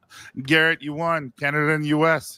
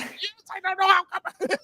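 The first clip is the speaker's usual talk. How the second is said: much higher, half-shouted mock Chinese accent; mimicking a coworker's accidental Chinese accent; imitated Chinese accent. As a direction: blurt it out in a hammed-up Chinese accent. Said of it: "Did you just have a Chinese accent?".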